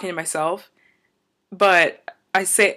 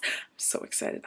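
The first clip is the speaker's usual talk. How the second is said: hushed, breath-only whisper of glee; excited whisper; 13 dB quieter than usual. Real, not acted. Vlogger to viewers after shouting about her show, excited whisper. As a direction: drop to a tiny whisper, barely containing excitement.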